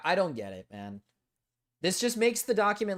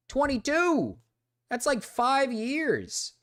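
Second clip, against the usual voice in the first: high, drawn-out exclamation; mock outrage at the timeline; incredulous; 12 semitones higher, much slower than usual. Real, not acted. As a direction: stretch it out in disbelief. Stunned at the age gap.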